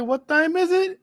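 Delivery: a hammed-up Chilean accent on the question; imitating Stephanie Vaquer's accent. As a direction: tease her with a playful imitation of her Chilean accent. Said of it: "In her accent and everything".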